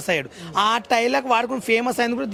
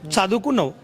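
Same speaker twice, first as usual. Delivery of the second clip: usual speaking voice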